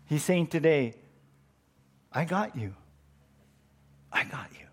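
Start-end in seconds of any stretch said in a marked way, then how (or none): 2.1-4.6 softer, fading into a whisper; soft reassurance. Acted. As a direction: breathe the promise close and gentle. God's reassurance, voice drops to whisper.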